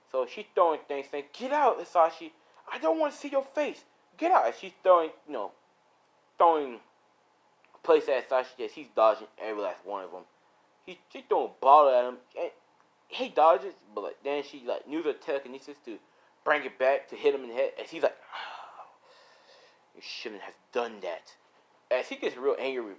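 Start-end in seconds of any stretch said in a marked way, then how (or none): 1.3-4.5 high-pitched angry yelling; acted shrieking outrage; furious wife. Wife throwing things, ordering him out. 18.3-21.3 sighed, low villain warning; hushed, ominous disapproval; quiet menace. Acted. Villain's warning after being hit.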